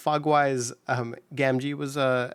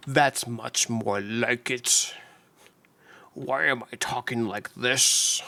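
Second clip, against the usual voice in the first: slow, Scottish-tinged Sean Connery voice; Sean Connery impression; slower than usual. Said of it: "uh, Sean Connery?".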